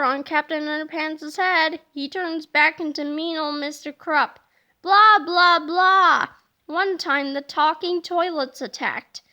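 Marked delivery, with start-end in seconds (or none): 4.8-6.3 loud, mocking blah blah blah; grumpy nagging. The mean principal scolds the boys, loud mock nagging. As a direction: drone on like a cranky principal.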